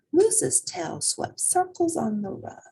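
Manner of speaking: ordinary delivery, nothing marked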